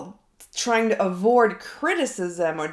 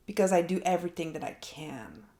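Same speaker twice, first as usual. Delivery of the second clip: ordinary delivery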